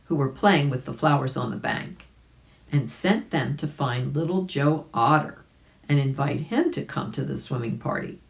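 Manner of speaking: usual speaking voice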